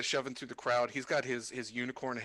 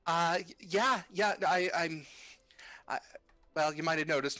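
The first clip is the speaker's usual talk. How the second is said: higher, louder hesitant character voice; lightly voiced nervous pilot; stammering shy girl; 5 semitones higher, 4 dB louder than usual. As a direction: stumble over the answer bashfully. DM voices Lucina answering.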